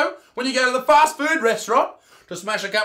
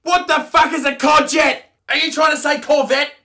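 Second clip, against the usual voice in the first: loud, higher furious yell; mock outraged shout; 6 semitones higher, 6 dB louder than usual. Shouting at host Abby over courgette, hammed-up fury. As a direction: blow up at the word, hammed-up. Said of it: "I'm absolutely pissed off".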